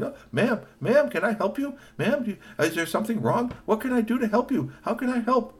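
high, eager robot voice; helpful hotel bot. Acted. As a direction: chirp it like a keen helper bot. Hotel bot offers help.

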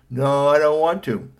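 loud, drawn-out sulky refusal; grumpy boy. A narrator's reading. Son refuses mother over homework, grumpy. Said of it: "He was looking so grumpy".

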